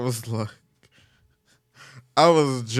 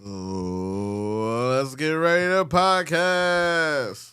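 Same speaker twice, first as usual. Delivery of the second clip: slow drawn-out ring announcer call; 4 semitones higher, much slower than usual. Pretend. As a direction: stretch it like a boxing announcer. Parody boxing announcer intro.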